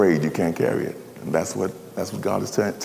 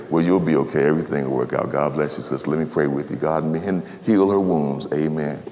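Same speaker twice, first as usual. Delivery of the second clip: mock soothing; 7 semitones lower than usual. Minister dodging the hard message, low and soothing. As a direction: coo empty comfort.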